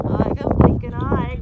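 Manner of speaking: loud, slow dragon groan. A narrator's reading. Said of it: "groaned Drufus".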